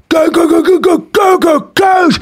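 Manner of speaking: high, rapid shouted thud noises; imitated tumbling noise. Son mimics dad falling down the stairs, shouted thud noises. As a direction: voice a body bumping down the steps.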